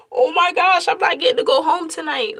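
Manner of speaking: high, loud panicked "oh my gosh"; retold shock at the baby coming; shocked realization. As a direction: burst out in alarm.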